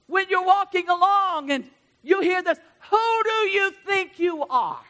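loud, high, fervent pulpit preaching; preaching at full force. Acted. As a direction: rouse the church from the pulpit.

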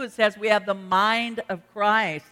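normal talking voice

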